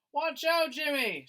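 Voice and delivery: high, loud warning shout; frightened mother yelling. Acted. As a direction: shout to Jimmy before the sled slides. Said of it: "shouted his mother".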